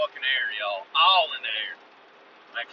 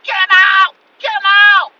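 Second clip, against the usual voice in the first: very high, loud distant-style holler; somebody yelling; 14 dB louder, slower than usual. Retold or imitated. What townsfolk hear amid gunfire, high crazed yell. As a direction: scream it out across the night. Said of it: "you hear somebody yell".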